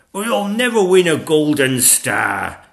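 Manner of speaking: put-on loud, slow young-dragon wail; despairing cry. A narrator's reading. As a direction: lament it loudly as a little dragon. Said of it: "he cried".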